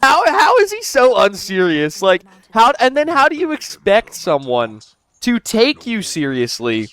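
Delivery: can't believe how unserious he is; exasperated disbelief. Real, not acted. Commentator to viewers, outraged and incredulous. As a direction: pitch jumps way up in incredulous outrage.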